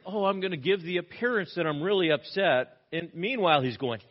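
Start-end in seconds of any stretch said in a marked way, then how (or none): 0.1-2.8 higher-pitched put-on indignation; mock upset show. High priest puts it on for show, mock upset voice. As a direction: make a show of being upset, hammed-up. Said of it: "He puts it on for show".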